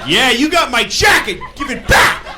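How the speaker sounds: loud, high demanding shout; argumentative yell. Acted. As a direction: bellow demands in a mock roadside row. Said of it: "I'm gonna argue about something else".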